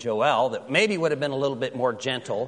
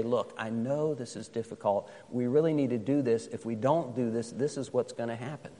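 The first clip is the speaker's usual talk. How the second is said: put-on softer, soothing words to a child; gentle, careful; 4 semitones lower, 5 dB quieter than usual. Shown as a demonstration. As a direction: ease a scared child into it softly. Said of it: "very gently and carefully say".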